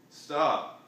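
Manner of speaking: loud high call of stop; girl shouting stop. Acted. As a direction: shout after the runaway hedgehog. Lisa calls after Hedgie.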